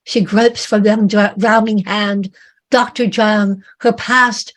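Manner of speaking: usual speaking voice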